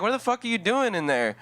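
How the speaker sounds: high, hammed-up angry mom; exaggerated mom. Mom at the locked door, hammed-up high demand. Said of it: "But she didn't say it like that".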